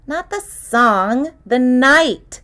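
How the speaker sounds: slow, scornful correction; scoffing. Acted. Girl correcting the detective about the blue moon, scoffing. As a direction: set him straight with a scoff. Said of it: "she scoffed".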